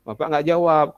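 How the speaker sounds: ordinary delivery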